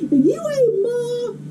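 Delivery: high, slow, stretched-out whine; whining kid brother. Retold or imitated. Brother in trouble with the older sibling, whining. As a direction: imitate a brother's drawn-out protest. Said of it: "Whenever they got in trouble".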